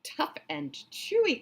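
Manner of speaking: high, slow scornful crocodile cry; enormous crocodile crying out. Acted. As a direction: sneer back at his friend's excuse. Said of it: "cried the enormous crocodile".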